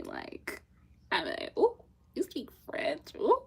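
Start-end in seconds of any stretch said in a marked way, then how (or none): 1.6-3.4 high, giddy mock swoon; smitten reaction. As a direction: swoon playfully, pitched up. Acts out attraction to French speakers.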